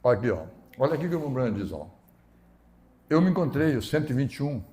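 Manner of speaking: ordinary delivery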